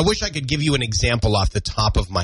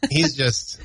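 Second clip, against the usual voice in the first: ordinary talk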